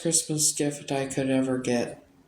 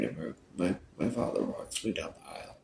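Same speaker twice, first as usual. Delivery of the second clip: much softer and lower, crying through the words; real tears breaking the voice; 8 semitones lower, 7 dB quieter than usual. Real, not acted. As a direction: choke up on her father walking her down the aisle. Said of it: "I said I didn't wanna fucking cry".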